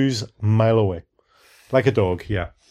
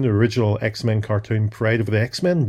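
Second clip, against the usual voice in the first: ordinary talk, nothing marked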